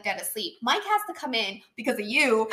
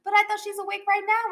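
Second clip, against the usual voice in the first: put-on higher boy voice; imitating a protesting kid; 10 semitones higher than usual. Friend mimics the boy's excuse, higher kid voice. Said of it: "he's like".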